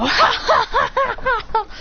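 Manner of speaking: high, acted belly of ha-ha-ha; mocking laughter. Onlookers laughing at the beaten hero, high mocking laughter. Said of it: "they start laughing at him".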